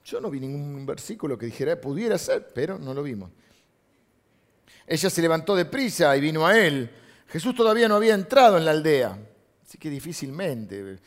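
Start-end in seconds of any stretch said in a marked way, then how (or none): 4.9-9.2 loud, raised Bible reading; emphatic scripture reading. Acted. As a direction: proclaim the verse to the congregation.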